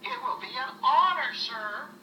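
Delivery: proud Rudolph. Acted. Rudolph answering Santa, eager and proud. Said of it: "replied Rudolph".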